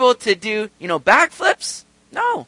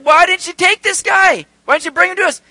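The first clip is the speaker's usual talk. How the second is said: indignant demand; 12 semitones higher, 9 dB louder than usual. Retold or imitated. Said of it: "They were like".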